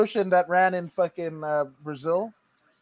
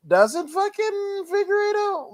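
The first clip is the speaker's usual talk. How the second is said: drawn-out high; 13 semitones higher, slower than usual. Real, not acted. Drawn-out comic question.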